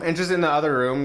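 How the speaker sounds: ordinary delivery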